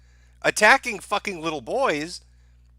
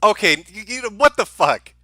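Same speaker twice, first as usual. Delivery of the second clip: exasperated outburst; 5 semitones higher, 7 dB louder than usual. Real, not acted. Reacting to the host's claim.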